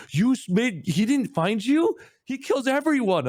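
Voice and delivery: very high, loud disbelief; astonished brother. Retold or imitated. Brother amazed that the kid survived. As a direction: gasp it out in disbelief. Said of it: "It's like".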